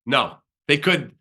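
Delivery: ordinary talk, nothing marked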